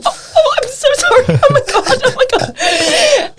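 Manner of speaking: oh my God, caught out and laughing; flustered apology. Retold or imitated. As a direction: gush out the apology in embarrassed laughter.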